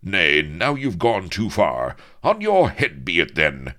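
now you've gone too far, draw; captain provoked into drawing his blade; offended challenge. Acted. Captain accepts the duel.

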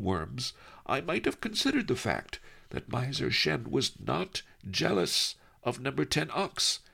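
put-on a sly old scholar savouring the joke; lightly voiced old Master Li, amused; old sage Master Li. Li Kao musing in story.

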